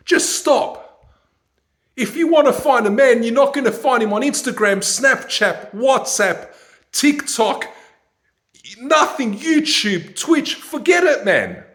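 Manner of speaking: loud, high ranting; exasperated rant. Real, not acted. Fed up, lecturing women on Instagram loudly. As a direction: shout it in frustration.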